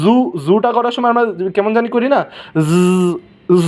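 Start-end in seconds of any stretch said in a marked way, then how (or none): 0.0-0.2 জু opening on a long zzz; buzzed zoo. Shown as a demonstration. Teacher likening Z to a buzz, drawn-out zoo. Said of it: "এরকম". 2.7-3.6 জ as a pure buzzing zzz; sustained z. Shown as a demonstration. Teacher isolating the sound for learners, sustained buzz. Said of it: "এরকম".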